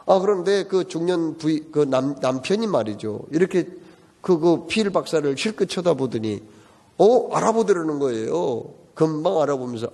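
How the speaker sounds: ordinary delivery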